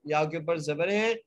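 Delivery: ordinary delivery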